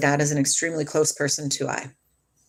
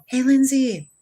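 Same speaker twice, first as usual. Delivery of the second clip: imitated calling voice; 7 semitones higher, slower than usual. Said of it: "I have heard my name called".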